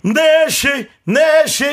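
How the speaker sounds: loud jingle call. Acted. Hosts cue the station jingle.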